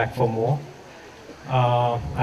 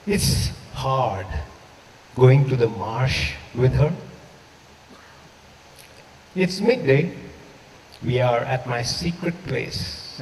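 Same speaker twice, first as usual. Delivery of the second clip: measured, unhurried poetry reading; reading a poem for a lost friend at a literary gathering; 3 dB quieter than usual. Acted. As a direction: read the poem to the room, letting lines breathe. Reads second poem aloud.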